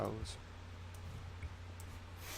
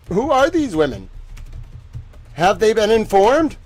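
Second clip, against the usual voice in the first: louder, insistent repeat of the questions; demanding repeat; 8 semitones higher, 7 dB louder than usual. Real, not acted. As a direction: press the question harder.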